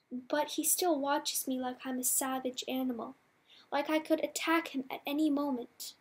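plain reading voice

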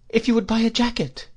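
put-on high, timid boy's voice; nervous, high-pitched request; trembling. A narrator's reading. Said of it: "I said trembling".

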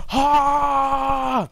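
high shouted hammed-up scream; mock rage scream. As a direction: let out a fake furious cry. Mock rage cry mid-game.

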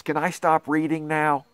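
ordinary delivery, nothing marked

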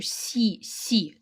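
сі whispered slowly; whispered syllable. Shown as a demonstration. Teacher whispers сі to pupils. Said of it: "м'який".

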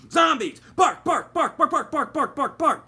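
loud, high, fast barks; dog yapping its head off; excited barking. A narrator's reading. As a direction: bark wildly at the intruders.

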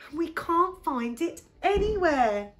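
high, loud acted dismay; distressed complaint. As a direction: report it high and upset. Pip reports the lost teddy.

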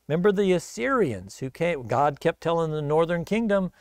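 usual speaking voice

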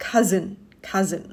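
"cousin" as KUH-zun, weak second vowel; correct pronunciation. Shown as a demonstration. Teacher repeats after explaining the vowels, correct "cousin" as KUH-zun. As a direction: say "cousin" neatly with a z. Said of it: "ข้างหลังเป็นสระเออ".

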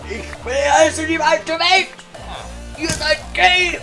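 loud high triumphant shout. Acted. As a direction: yell it up high, slowly. Gamer rage outburst.

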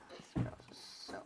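whispering. Real, not acted. Whispered aside about sister.

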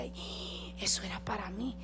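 whispered to herself, longing; a secret thought breathed out; retelling her hidden wish in a whisper; inner whisper. Retold or imitated. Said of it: "yo por dentro decía".

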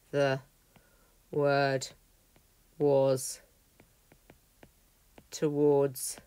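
slow reading, long pauses between words; each word set apart, slow and deliberate. Shown as a demonstration. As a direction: read it one word at a time. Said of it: "let's work through it word by word".

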